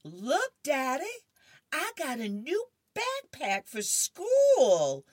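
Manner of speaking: put-on high, loud, eager kid voice; a kid bursting with excitement; excited little boy. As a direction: show off the new backpack like a thrilled child. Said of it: "little Barry comes in the kitchen and goes".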